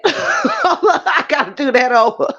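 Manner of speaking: high-pitched words breaking into laughter; real helpless giggles; laughing hard. Real, not acted. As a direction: try to talk while cracking up. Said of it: "I'm laughing so hard".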